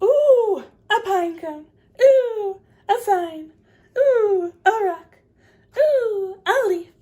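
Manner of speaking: high, loud oohs at each find; delighted gasps. Acted. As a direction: marvel at every little thing. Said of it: "she has plenty to say".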